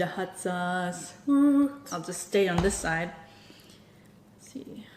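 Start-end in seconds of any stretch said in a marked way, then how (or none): 4.4-4.9 whisper. Real, not acted. Peering at the eggs.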